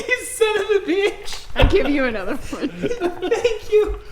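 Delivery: high, loud laughing squeal; laughing outrage. Acted. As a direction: laugh through the fake outrage. Mock outrage over the pie.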